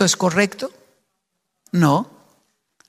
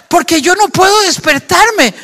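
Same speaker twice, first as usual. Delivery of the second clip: laughing through the words, pitched up; 8 semitones higher, 5 dB louder than usual. Real, not acted. Preacher laughing to the church about sleeping through Switzerland, laughing. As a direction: chuckle it out, amused at himself.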